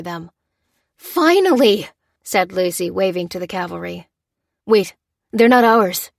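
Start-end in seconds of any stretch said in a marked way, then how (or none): 1.0-1.9 high, loud burst of relief; relieved exclamation. A narrator's reading. Lucy waving to the trucks, relieved loud cry. 4.7-6.1 loud, slow warning shout; alarmed cry. A narrator's reading. Lopez stopping Lucy from waving, loud warning. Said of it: "cried Lopez".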